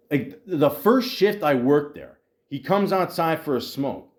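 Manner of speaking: usual speaking voice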